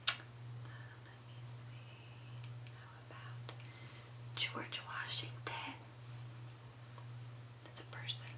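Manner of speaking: hushed, voiceless whisper. Shown as a demonstration. Teacher telling a puppet her answer, whispering. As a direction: whisper it very softly, like a secret. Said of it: "I am just going to whisper".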